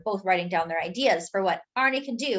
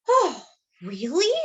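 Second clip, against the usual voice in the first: relieved; 5 semitones higher, 5 dB louder, slower than usual. A narrator's reading. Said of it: "with a huge sigh of relief".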